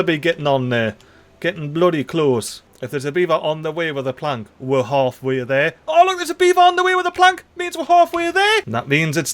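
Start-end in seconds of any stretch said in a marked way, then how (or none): 5.9-8.6 high, fast burst of delight; thrilled that the hope came true; quick high squeal of glee; excited. Real, not acted. Sees the beaver with plank.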